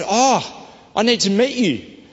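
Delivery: high, slowed and gushing; finally, you're the one; excited. Retold or imitated. Greeting the long-sought shop manager, high and excited.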